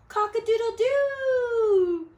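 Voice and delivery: high, loud, slow crowing; rooster crow. Acted. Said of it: "crowed the rooster".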